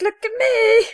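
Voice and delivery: high, eager cartoon shout; Mister Meeseeks voice. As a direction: pipe it up like a cartoon helper. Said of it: "I'm Mister Meeseeks".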